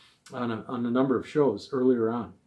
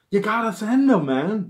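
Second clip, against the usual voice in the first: higher and louder, eager plea; 8 semitones higher, 6 dB louder than usual. Retold or imitated. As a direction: push him to send the photos. Urging friend to send photos.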